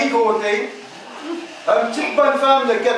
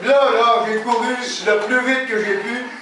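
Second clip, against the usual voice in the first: ordinary delivery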